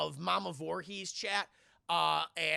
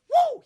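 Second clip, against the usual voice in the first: very high, loud "woo"; startled whoop; 16 dB louder than usual. Retold or imitated. Bo dodging a swung pan, startled whoop. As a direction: imitate a sudden yelp while dodging.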